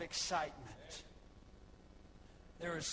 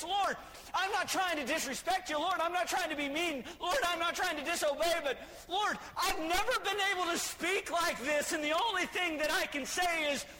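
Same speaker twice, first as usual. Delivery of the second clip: high, loud pleading preaching; fervent preaching; 7 semitones higher, 5 dB louder than usual. Acted. Healed man pleading with the Lord, preached loud. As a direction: plead with the Lord at full force.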